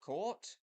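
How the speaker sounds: court, said high with a drawn-out k; court vowel. Shown as a demonstration. Showing how court sounds in his accent. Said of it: "in words like".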